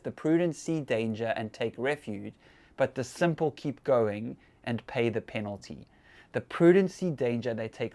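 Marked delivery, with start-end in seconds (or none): 0.0-5.9 slow, quiet reading of the verse; scripture reading. Shown as a demonstration. Pastor reading Proverbs to the congregation, slow and soft. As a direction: read the proverb slowly and softly. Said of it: "which says".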